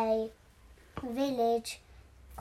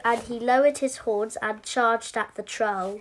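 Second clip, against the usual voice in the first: usual speaking voice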